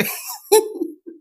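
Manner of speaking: helpless high-pitched laughing. Real, not acted. Streamer reading comments on the university, high laugh. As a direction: burst out laughing, high and airy.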